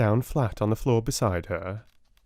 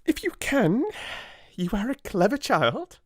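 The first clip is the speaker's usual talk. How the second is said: sharp Miss Minchin; 12 semitones higher than usual. A narrator's reading. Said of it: "drawing in her mouth sharply".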